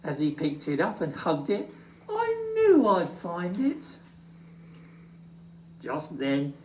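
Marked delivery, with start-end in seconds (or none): 2.1-4.0 Little Bear voice. Acted.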